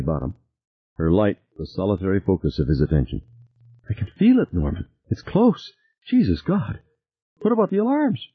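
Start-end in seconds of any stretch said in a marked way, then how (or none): 3.9-8.3 high, panicked, gasping; scared while running, out of breath; frightened and breathless. A narrator's reading. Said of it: "Her breath came in hissing gasps".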